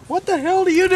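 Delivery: high, loud outburst of disbelief; joke-teller's exasperated shout; incredulous yell. Acted. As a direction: blurt it out, pitch shooting way up. Officer shocked in a told joke.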